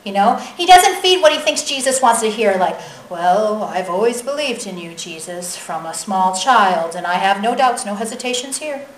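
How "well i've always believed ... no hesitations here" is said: low, subdued mock-pious recital; rehearsed piety. Believer telling Jesus what he wants to hear, mock pious. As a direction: recite it like a too-perfect practiced line. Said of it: "he doesn't feed Jesus a practice line".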